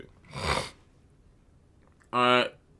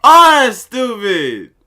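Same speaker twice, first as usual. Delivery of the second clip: loud, high, drawn-out outburst at losing; loud frustrated gamer rant; frustrated yelling; 8 semitones higher, 5 dB louder, slower than usual. Real, not acted. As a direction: yell at the screen in disbelief. Loses in game, yells frustrated.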